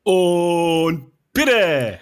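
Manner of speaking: energetic cue. Shown as a demonstration. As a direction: call it out with energy. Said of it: "Du kannst nicht immer so gelangweilt Bitte sagen".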